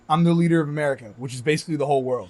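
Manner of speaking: loud, proud announcement; boastful president voice. Retold or imitated. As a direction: puff up like a president. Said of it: "And he's like".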